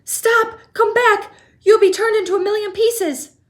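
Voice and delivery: high, loud frantic scream; panicked scream. A narrator's reading. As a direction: beg him to stop, terrified. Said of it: "screamed Missus T V".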